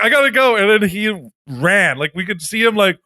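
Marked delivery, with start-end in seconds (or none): 0.0-0.6 panicked exit. Retold or imitated. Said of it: "he's like".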